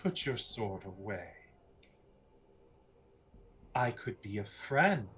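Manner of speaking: measured, placating enchantress; persuasive villainess. Acted. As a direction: talk them down gently, with pauses. Game master voices Lucretia.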